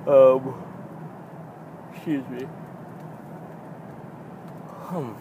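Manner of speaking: talking through a yawn; words squeezed by a yawn. Real, not acted. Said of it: "I can't stop yawning".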